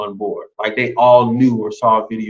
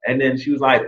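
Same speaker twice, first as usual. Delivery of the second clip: normal talking voice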